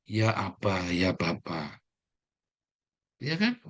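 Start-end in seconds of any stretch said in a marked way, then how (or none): 0.1-1.8 hushed, low call to the Father; soft intimate prayer. Retold or imitated. Preacher voices believers calling God, hushed and tender. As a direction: murmur it like a close prayer. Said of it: "Kita memanggilnya".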